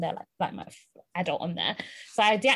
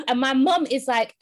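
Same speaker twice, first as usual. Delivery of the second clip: usual speaking voice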